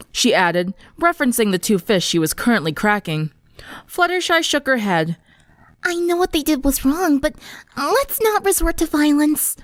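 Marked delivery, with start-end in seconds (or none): 5.8-9.6 put-on timid gentle girl. Fluttershy gently urges friends not to fight, timid.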